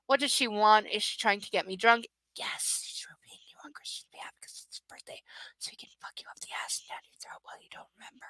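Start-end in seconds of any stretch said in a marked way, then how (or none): 2.4-8.3 very soft, voiceless whisper; hushed sarcastic commentary; whispered crude aside. Real, not acted. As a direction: hiss the answer under your breath. Reader's crude aside answering the book.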